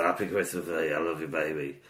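ordinary talk, nothing marked

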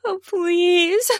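high, moaning plea; high-pitched, drawn-out breathless begging. A narrator's reading. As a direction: beg high between pants. Panting plea in love scene.

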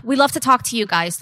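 ordinary talk

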